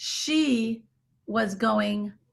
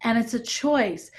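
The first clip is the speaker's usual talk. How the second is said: ordinary talk, nothing marked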